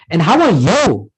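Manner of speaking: "you" stressed with a big pitch swing; emphasis on YOU. Shown as a demonstration. Teacher showing how emphasis returns a greeting, "you" strongly stressed. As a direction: lean on "you" to turn the question back. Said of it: "just that bit of emphasis".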